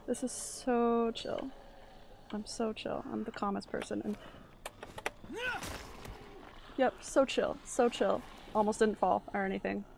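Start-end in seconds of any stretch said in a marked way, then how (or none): 0.1-8.2 slow drawn-out so chill, ironic; sarcastic calm. Real, not acted. Nervous player insisting she is chill, sarcastic. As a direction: pretend you are totally relaxed.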